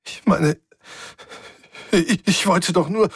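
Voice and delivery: höher, stockend unter Tränen; voice breaking. A narrator's reading. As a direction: Fassung verlieren, stammeln. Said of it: "Die Kontrolle über ihre Stimme entglitt". (mit versagender Stimme, viel höher)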